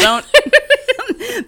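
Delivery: laughing. Real, not acted. Laughing mock warning.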